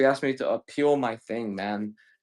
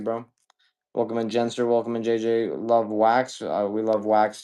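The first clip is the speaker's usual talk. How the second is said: ordinary delivery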